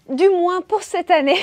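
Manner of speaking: high, loud teasing aside before a laugh; amused, half-laughing tag line; playful aside. Real, not acted. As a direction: toss it off with a cheeky grin. Joking aside about her reading pile.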